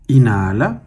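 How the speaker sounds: slow single-word cues, each vowel drawn out; calm, measured breath counting with long silences; guided breathing meditation. Acted. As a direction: pace someone's breathing, stretching each cue unhurriedly.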